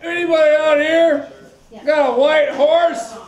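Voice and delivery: high, loud yelling call; shouting cowboy. Acted. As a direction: bellow it across the saloon. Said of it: "He goes".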